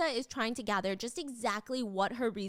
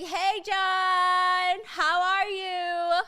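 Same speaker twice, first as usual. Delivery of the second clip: loud, high, drawn-out hello; mock called-out greeting; 7 semitones higher, 6 dB louder, much slower than usual. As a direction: call out a greeting with long, high vowels. Acting her calling greeting to John.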